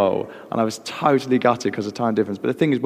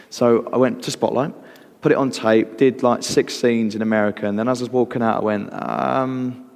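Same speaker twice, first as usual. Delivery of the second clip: ordinary delivery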